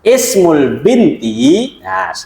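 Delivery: drawn-out, word by word Arabic; slow Arabic reading. Shown as a demonstration. Teacher reading the example sentence to the class, slow and drawn out. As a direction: spell the Arabic out slowly for the students.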